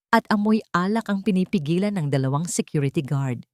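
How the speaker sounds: ordinary delivery, nothing marked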